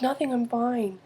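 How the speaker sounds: put-on high, soft little-girl reply; daughter brushing off. Daughter brushes off worried mom, soft and high. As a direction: imitate a child insisting she's okay.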